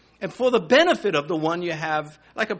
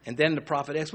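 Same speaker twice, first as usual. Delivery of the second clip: ordinary talk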